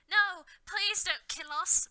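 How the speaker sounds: high, loud, slow desperate plea; pleading. A narrator's reading. Vampire begging Voldemort, loud high plea. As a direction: beg for your life, stretching each word. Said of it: "pleaded Vampire".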